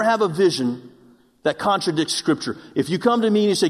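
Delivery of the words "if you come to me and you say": ordinary talk, nothing marked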